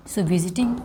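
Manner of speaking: unvoiced whisper while thinking; teacher whispering while recalling a spelling; whisper to himself. Real, not acted. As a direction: whisper the word under his breath. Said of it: "लो visit के spelling में भूल गया".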